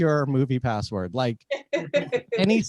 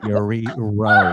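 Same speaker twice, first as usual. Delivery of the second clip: drawn out very slowly, lower pitch; sarcastic guess; 6 semitones lower, 4 dB louder, much slower than usual. Acted. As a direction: drag out each word mockingly.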